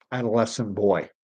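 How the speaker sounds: ordinary talk, nothing marked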